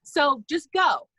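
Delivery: breezy refusal, sure God will save him; mild put-on voice of the stubborn man. Stubborn man refusing helicopter rescue.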